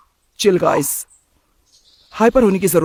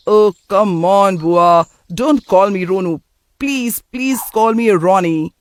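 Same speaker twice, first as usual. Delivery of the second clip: drawn-out, whiny protest, slightly louder; exasperated pleading; 2 semitones higher, 3 dB louder, slower than usual. Acted. Grown nephew begging his aunt to drop the nickname, exasperated pleading. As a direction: stretch out each word of the plea.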